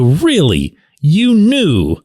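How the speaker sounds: retold disbelief, pitch jumping high on knew; can't believe he already knew; incredulous. Reporter to Kenny, mock incredulous.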